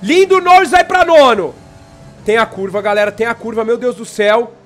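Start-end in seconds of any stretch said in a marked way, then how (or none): 0.0-1.5 loud excited race call; motorsport commentator. Real, not acted. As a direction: shout the overtake as it happens. Live race play-by-play.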